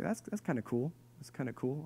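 very soft, hesitant approval; underwhelmed reaction. Retold or imitated. Dad reacting to daughter's wish list, quietly bemused. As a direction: say it quietly, almost to yourself. Dad's underwhelmed reply, retold.

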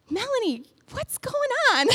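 high-pitched, mock-bewildered question; lightly hammed-up concern; puzzled concern. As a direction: ask it high, as if baffled by a friend. Retells asking crying singer what's wrong.

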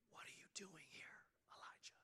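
hushed, soft whisper of God's voice; gentle whisper. A narrator's reading. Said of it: "came a gentle whisper".

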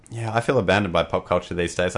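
ordinary delivery

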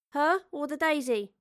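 high, teasing question; cheeky, lifted voice; smirking. Acted. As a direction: tease with a smirk. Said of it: "Astrid smirked".

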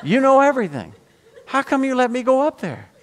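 high-pitched, mock-indignant protest; exasperated, hammed-up whine at God; retold complaint to God. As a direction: plead your grievance upward in a high voice. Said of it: "I got mad at God that night".

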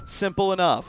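ordinary talk, nothing marked

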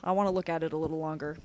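usual speaking voice

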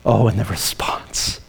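hushed dramatic whisper. Real, not acted. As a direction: draw them in with a secret. Dramatic hush before the climax.

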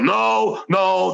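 high, startled double no; mock disbelief. Residents who can't grasp him being on TV, high disbelieving protest.

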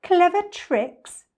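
high, loud, very slow disbelief; drawn-out, high-pitched mocking question; incredulous cry. A narrator's reading. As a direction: echo it back in scornful amazement. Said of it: "cried the not-so-big one".

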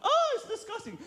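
very high, comic squeal of revulsion; exaggerated high-pitched disgust; mock disgust. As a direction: recoil in hammed-up horror. Acted recoil, repeated.

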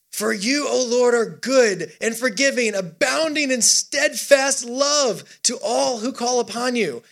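high, stretched-out words of praise; worshipful psalm reading. Acted. Preacher reading David's turn to worship, high drawn-out praise. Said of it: "David changes gears for worship".

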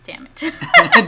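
high, loud playful curse; frustrated outburst. Real, not acted. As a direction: blurt it out in mock annoyance. Name slips out, mock frustration.

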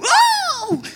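sudden high shouted Oh, far above the voice; a high joyful yelp imitating dad at prayer; retold scream. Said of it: "sometimes you will hear him scream".

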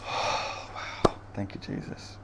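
soft breathy awe, real thanks; awed whisper. Real, not acted. Preacher marvelling to Jesus, real whispered thanks. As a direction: whisper it in wonder.

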